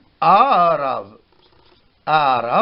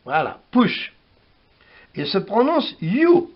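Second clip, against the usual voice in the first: ordinary talk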